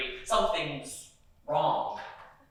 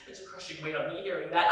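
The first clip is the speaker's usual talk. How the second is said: ordinary talk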